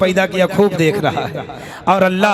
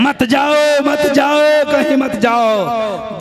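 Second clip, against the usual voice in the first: raised, higher-pitched call after someone leaving; 5 semitones higher, 4 dB louder, slower than usual. Real, not acted. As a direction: call them back across the crowd. Calls after people leaving.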